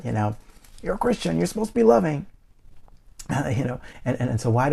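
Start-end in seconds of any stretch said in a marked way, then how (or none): 0.8-2.3 high, mocking churchy voice; mocking quote. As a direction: parrot the preachy line in a high sing-song. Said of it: "anybody ever said that".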